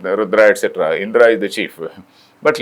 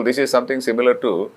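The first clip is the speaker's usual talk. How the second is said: usual speaking voice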